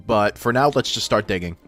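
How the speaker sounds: normal talking voice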